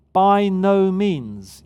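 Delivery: very slow, loud and weighty; emphatic no. Acted. Preacher reading Paul's denial, slow and emphatic. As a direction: lean hard on every word of By no means. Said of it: "It's incredibly emphatic".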